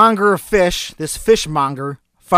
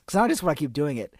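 usual speaking voice

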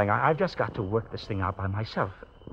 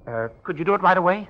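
anxious urgent; 9 semitones higher, 8 dB louder than usual. Acted.

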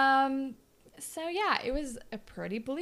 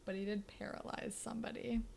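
softer, dark-humoured whisper; 9 dB quieter than usual. Real, not acted. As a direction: whisper the grim joke.

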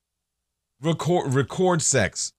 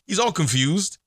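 usual speaking voice

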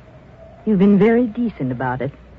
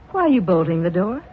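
ordinary delivery

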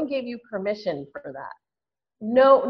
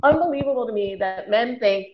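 normal talking voice

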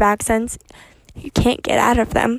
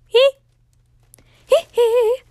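high falsetto "hee hee" yelp; short, high sung yelps; 12 semitones higher, 7 dB louder than usual. Pretend. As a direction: squeal a pop-star "hee hee". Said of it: "I still got that [name]".

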